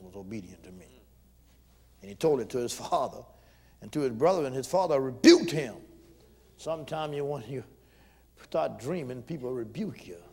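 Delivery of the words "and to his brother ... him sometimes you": ordinary talk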